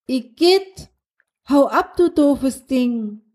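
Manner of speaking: hoch und langsam, voller Ekel; angeekelt. Acted. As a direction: schreckt angewidert vor der Schnecke zurück. Schnecke kriecht ihr Bein hoch. (angeekelter, hoher Aufschrei)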